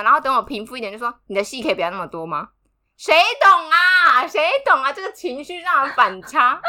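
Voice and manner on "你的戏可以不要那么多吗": fast, quieter, deadpan retort; calm deadpan. Retold or imitated. Partner telling the crying narrator to stop the drama, fast cool deadpan. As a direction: brush off the drama coolly. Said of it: "他就很冷静的看着我说".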